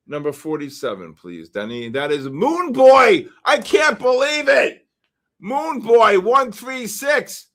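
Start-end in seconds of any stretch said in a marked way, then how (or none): 1.9-7.5 loud, high, excited shout; thrilled, incredulous announcer; amazed announcing. Real, not acted. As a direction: shout the winner's name in disbelief. Amazed announcing an unlikely raffle winner.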